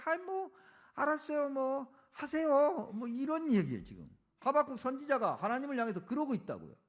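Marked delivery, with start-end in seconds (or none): 0.0-2.9 high, huffy mock concession; dragged-out grudging go-ahead, pitched well up; sarcastic giving in. Prophet telling God to go ahead, sarcastically resigned. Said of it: "빈정되는 거예요".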